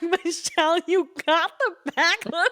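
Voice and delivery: high, squeaky with laughter; cracking up. Retold or imitated. Daughter retelling Dad's answer to Mom, cracking up. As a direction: barely get the quote out through laughter.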